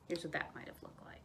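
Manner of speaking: soft unvoiced aside; whisper. Shown as a demonstration. Narrator showing a picture, soft whisper. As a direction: whisper it to the kids. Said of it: "aside to listeners".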